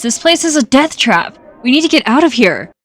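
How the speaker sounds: dramatic citizen voice. Acted. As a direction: sell the panic like a frightened settler. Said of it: "I don't know why I said it like that".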